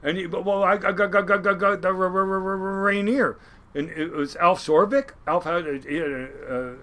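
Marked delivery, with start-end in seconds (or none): 0.3-3.4 mock stutter on the name; imitating a stutter. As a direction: stammer over got and r. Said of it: "real bad stutter".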